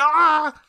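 high, short mock yelp of pain. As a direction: yelp like someone hit by a pie. Hit by launched pies.

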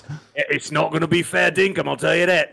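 rough attempt at an Australian accent; Australian slipping toward British. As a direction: put on a comic Australian voice. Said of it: "Your Australian sounds like, uh, sort of sounds like Jason Statham".

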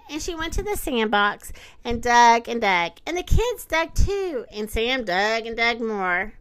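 plain reading voice

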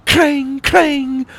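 high, loud ringing clang; imitated clashing blades; sword clangs. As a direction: ring it out like steel on steel.

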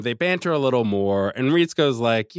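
ordinary talk

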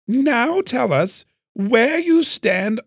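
high, slow, hammed-up British accent; badly faked high-pitched British accent. Shown as a demonstration. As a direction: put on a horrible, drawn-out British voice. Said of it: "do a British accent, although maybe I should".

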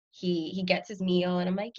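ordinary talk, nothing marked

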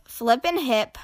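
plain reading voice

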